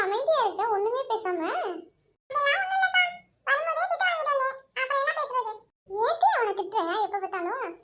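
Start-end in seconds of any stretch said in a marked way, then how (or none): 2.3-5.5 put-on a high, whiny boy's voice; high whining boy complaining to his aunt; childish boy voice. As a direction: sulk like a scolded little boy. Young boy complaining, scolded.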